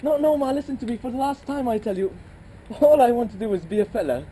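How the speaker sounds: low, fast, pleading with rising force; panicky son. Acted. Son begging his mother before the wedding, panicked. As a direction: push back hard against growing up.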